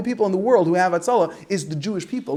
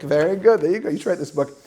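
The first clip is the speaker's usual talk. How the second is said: normal talking voice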